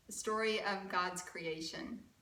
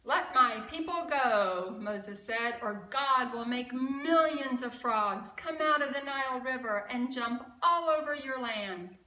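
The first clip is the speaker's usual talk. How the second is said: louder, firm warning to a king; commanding Moses; 4 semitones higher, 8 dB louder than usual. Acted. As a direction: demand it like a prophet. Moses warns Pharaoh in story.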